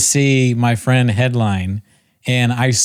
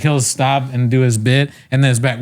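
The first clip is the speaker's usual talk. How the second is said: ordinary talk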